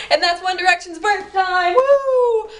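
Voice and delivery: giddy fangirl announcement; excited cheering. Real, not acted. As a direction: pitch climbing into a high whoop. Cheering band's anniversary.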